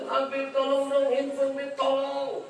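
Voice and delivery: high, pleading voice, close to tears; crying plea. Retold or imitated. Pilgrim begs the Habib for her phone, tearful and high. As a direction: beg the Habib for help, whimpering. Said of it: "Udah nangis".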